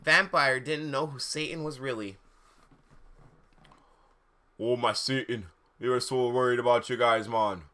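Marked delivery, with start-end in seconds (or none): 4.6-7.6 put-on laid-back character voice; lightly voiced worried dude; Vampire's voice. A narrator's reading. Vampire greeting the friends, lightly put-on worried voice. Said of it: "Vampire said".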